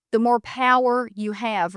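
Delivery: usual speaking voice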